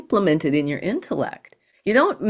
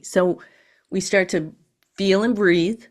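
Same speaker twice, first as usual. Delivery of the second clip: ordinary delivery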